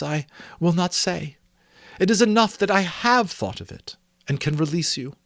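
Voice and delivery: put-on Belle, earnest young woman. Belle confronting Scrooge about his love of gain, earnest.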